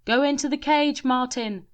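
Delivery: high, loud command; shouted. A narrator's reading. Shelley urging Martin into the cage, loud and high. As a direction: call out urgently, high and loud. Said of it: "shouted Shelley".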